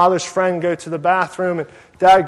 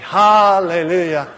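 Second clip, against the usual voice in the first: charismatic praise; 3 semitones higher, 5 dB louder, slower than usual. Retold or imitated. Relieved passenger praising God as the huge man walks by, mock-charismatic. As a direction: belt it out like a charismatic worshipper. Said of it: "I was, like, charismatic".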